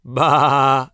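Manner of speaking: loud bleating baa; sheep bleat. A narrator's reading. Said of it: "answered the sheep".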